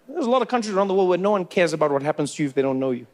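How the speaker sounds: normal talking voice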